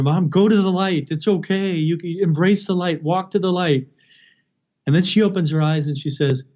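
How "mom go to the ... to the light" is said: high, pleading coax toward the light; pleading family members. Retold or imitated. Family urging dying mother, high pleading voice. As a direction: urge her gently on, voice lifted.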